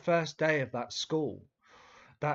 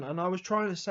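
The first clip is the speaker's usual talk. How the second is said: usual speaking voice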